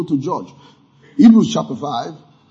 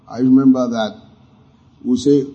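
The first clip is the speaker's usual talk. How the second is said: ordinary talk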